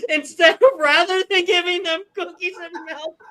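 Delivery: high, loud, helpless laughing speech; crying with laughter. Real, not acted. Host cracking up over the game answer, crying with laughter. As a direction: squeal the words through real laughter. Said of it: "I'm literally crying".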